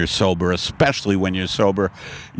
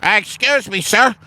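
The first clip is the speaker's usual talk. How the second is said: high student voice; 11 semitones higher, 3 dB louder than usual. Acted. Student confronting the teacher.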